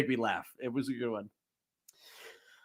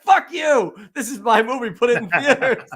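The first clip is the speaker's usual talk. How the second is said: louder, higher mock outburst; angry director; 6 semitones higher, 5 dB louder than usual. Director ranting at Amazon over streaming, mock furious. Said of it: "he's like".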